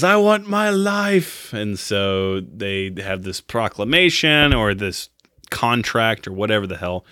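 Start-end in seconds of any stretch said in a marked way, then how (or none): 0.0-1.5 high, very slow, drawn-out plea; John pleading. Retold or imitated. Condemned husband begs for his life, high and drawn out. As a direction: stretch each word out as a desperate cry for life. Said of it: "and then he says".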